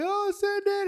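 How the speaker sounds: high-pitched frantic cry; high panicked voice. Retold or imitated. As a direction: squeak it out in a panic. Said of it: "they're like".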